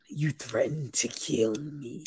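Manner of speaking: mock whisper. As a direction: whisper it like a cheap horror line. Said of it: "mocking Leah's whispered line".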